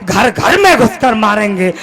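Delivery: rhythmic, emphatic verse declamation; declaimed patriotic poetry; poem recitation. Acted. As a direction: hammer out the defiant couplet in a steady beat.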